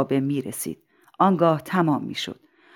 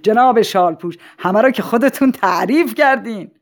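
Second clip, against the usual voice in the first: raised, jovial character voice; amused mayor; 5 semitones higher, 5 dB louder than usual. Acted. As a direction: tease him loudly, just after laughing. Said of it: "شهردار خندید و گفت". (شهردار خندان با صدای بلند)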